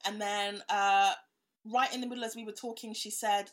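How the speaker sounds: ordinary talk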